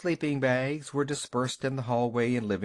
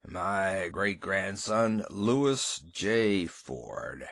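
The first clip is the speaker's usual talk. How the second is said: low, slow mournful pronouncement; solemn old man; 5 semitones lower, much slower than usual. A narrator's reading. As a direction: intone it like a dying patriarch. Gramps dictating the will.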